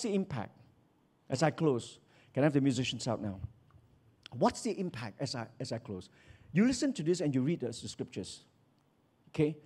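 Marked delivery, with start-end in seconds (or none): none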